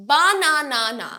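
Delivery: BANANA with every a a long open aa; overdone full vowels all through banana; wrong full vowels. Shown as a demonstration. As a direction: draw out banana as baa-naa-naa. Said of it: "না, okay?".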